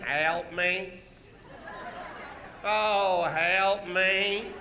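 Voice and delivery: low mock plea for help; a hint of melodrama heroine; lightly acted damsel. Shown as a demonstration. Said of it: "you run forward going".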